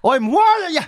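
high, lightly voiced stern dad; a hint of a fed-up father; mock dad warning. Driving dad warning the taunting kid, mock stern. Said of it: "So he's like".

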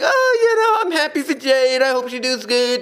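high, sing-song fake cheer; mock happy Tony Khan. Commentator mocking a rival boss, high fake cheer. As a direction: mimic a falsely cheerful boss. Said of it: "be all happy and all that and be like".

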